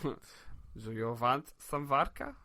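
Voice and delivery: put-on slow, low Russian-accented offer; mock Russian accent. Host playfully offers co-host a drink, mock Russian accent. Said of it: "That's your Russian accent?".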